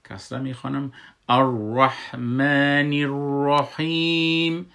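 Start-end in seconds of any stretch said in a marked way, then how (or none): 1.3-4.7 الرحمن الرحیم with a thick emphatic r, slow and loud; slow chant of الرحمن الرحیم, the r heavy. Shown as a demonstration. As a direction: recite الرحیم with a full heavy r. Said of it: "را را من مفخم میخوانم".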